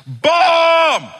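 high, loud drawn-out shout; re-enacted prank yell, full voice; top-of-voice yell. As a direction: yell it out across an airport. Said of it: "yelled at the top of my voice".

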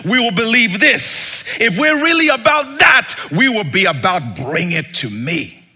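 loud preaching at full force; preaching shout. Acted. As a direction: belt it out like a preacher.

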